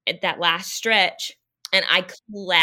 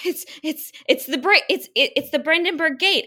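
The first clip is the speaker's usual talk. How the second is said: delirious hope; 8 semitones higher than usual. Retold or imitated. Exhausted runner hoping for finish.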